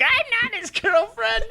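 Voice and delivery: high, slow, whiny mock protest; Misty. Misty denying she is Ash's girlfriend, upset high impression. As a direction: squeal an exaggerated, upset denial. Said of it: "that was a really offensive Misty impression".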